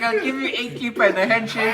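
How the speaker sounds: put-on playful Indian accent, laughing, high. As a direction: mimic a haggling seller in Indian accent. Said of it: "How was that an Indian accent?".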